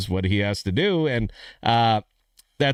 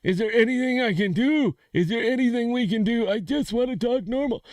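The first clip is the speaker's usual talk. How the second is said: much higher, odd squeaky voice; squeaky high-voiced man; 12 semitones higher than usual. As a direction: play the man with the tiny high voice. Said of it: "the man said".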